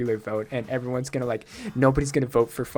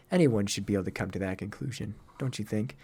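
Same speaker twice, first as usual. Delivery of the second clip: low, cool, superior voice; condescending game character; 5 semitones lower, 5 dB quieter than usual. Acted. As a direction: talk down to them in a lowered voice.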